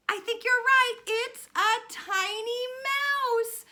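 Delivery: loud, high, thrilled announcement; high-pitched storytime excitement; excited reveal. Pretend. Excited reveal after children's guess.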